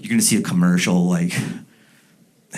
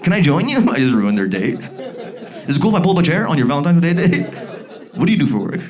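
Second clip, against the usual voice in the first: pushy intrusion; 8 semitones higher, faster than usual. Retold or imitated. Intruding on couples' date.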